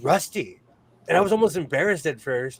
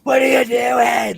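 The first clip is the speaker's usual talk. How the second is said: loud slow challenge, pitched up; shout; 9 semitones higher, 7 dB louder than usual. Retold or imitated. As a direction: yell it at the car. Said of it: "I was like".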